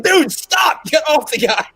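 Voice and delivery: very high, loud yell; imitated alarmed shout. Stopping a tourniquet round the neck, alarmed mock shout. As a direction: shout to stop a dangerous blunder.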